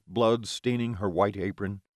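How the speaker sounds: ordinary delivery, nothing marked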